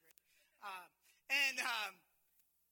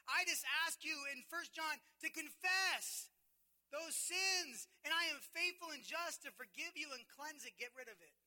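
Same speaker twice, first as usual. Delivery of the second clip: loud, high, driving sermon voice; full-force preaching; 7 semitones higher, 13 dB louder than usual. Acted. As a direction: preach it out to the congregation. Preaching God's promises.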